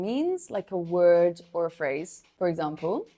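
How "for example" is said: ordinary delivery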